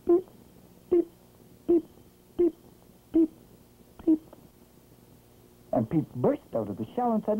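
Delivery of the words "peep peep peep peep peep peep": tiny high peeps, one at a time; spaced-out little chick calls; baby chick peeps. A narrator's reading. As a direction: peep like a chick, short and high. Chick peeping as it works.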